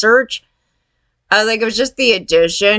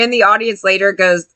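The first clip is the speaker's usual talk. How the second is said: usual speaking voice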